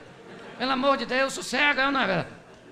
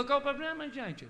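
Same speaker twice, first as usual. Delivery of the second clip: gentle question; 3 semitones higher, 9 dB quieter than usual. Retold or imitated. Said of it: "Chegou e falou".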